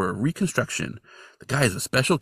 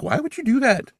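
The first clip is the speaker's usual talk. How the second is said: exasperated; 11 semitones higher than usual. Retold or imitated. Listener's exasperated reaction.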